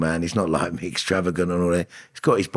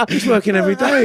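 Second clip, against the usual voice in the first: higher and louder, delighted; thrilled, pitched way up; excited; 6 dB louder than usual. Real, not acted. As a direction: gush about him with glee. Excited about brother's film work.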